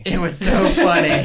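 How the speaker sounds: loud, high, laughing through it; laughing hard. Real, not acted. Co-host reacting to the clip, laughing loudly. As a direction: crack up while saying it.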